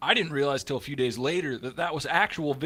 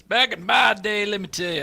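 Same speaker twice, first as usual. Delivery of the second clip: put-on old-timer voice; 7 semitones higher, 7 dB louder than usual. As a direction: draw it out like a grandpa starting a tale. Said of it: "Tell me about your heyday there, Pops".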